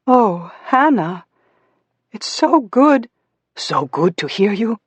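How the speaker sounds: high, emotional motherly welcome; overjoyed mother. A narrator's reading. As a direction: let relief lift your voice as you greet your child. Mother overjoyed to hear daughter.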